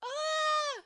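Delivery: high delighted shriek; excited squeal. Real, not acted. End of the episode, high excited squeal.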